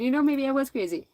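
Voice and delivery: usual speaking voice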